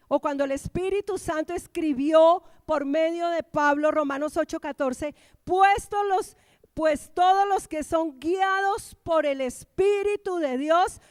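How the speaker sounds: emphatic preaching. Real, not acted. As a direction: drive the teaching home to the congregation. Predica con fuerza. (predicación enérgica y enfática)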